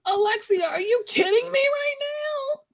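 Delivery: high, crying-out disbelief; high mock wail of disbelief; mock exasperated plea. Acted exasperated plea to Alexia.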